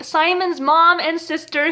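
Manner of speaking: usual speaking voice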